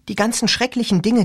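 lightly voiced teen girl; girl's voice. A narrator's reading. Rachel voiced.

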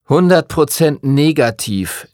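slow, sing-song mimicry of a teacher; mocking imitation. Timothy mimics the teacher to tease him, slow and mocking. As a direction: ape the teacher's words back at him, slowly. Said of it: "äffte Timothy ihn nach".

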